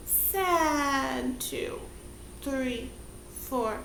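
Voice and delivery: sad, soft counting under the breath; quiet sad count. Shown as a demonstration. Teacher counting the sad shape softly. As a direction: let the count sink sadly. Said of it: "sad shape held four counts".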